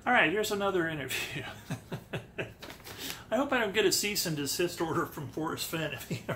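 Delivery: usual speaking voice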